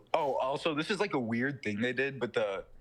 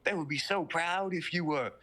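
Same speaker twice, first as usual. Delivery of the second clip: devil taunting; 6 semitones higher than usual. Retold or imitated. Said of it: "he's like".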